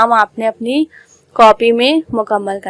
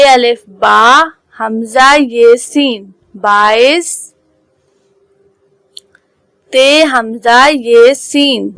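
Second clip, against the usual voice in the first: letter-by-letter recitation for pupils; teacher spelling drill; 3 dB louder, slower than usual. Acted. Urdu teacher spelling numbers aloud for her pupils. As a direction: sound out each letter, then the number.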